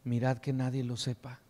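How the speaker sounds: low, hushed voice as if confiding; in the ear. Acted. Jesus privately cautioning the healed blind men, hushed and low. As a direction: say it softly, into someone's ear. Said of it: "les dice al oído".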